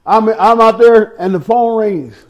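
ordinary talk, nothing marked

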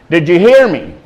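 ordinary talk

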